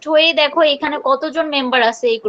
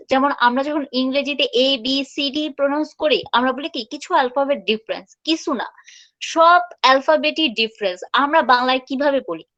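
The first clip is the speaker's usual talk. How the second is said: normal talking voice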